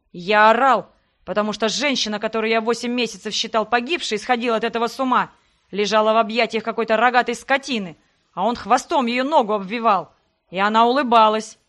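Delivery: loud, angry shouting. Acted. Sebastian stops holding back and shouts at her. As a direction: let go and shout it out. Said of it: "перешёл на повышенные тона".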